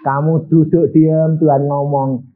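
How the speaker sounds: normal talking voice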